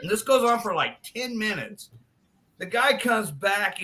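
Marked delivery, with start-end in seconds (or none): none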